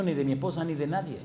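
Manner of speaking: normal talking voice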